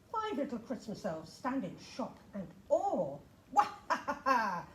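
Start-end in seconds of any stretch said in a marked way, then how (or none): 3.5-4.7 loud villain laugh; ice cream laughing. Acted. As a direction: cackle like a wicked ice cream. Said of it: "the ice cream laughs".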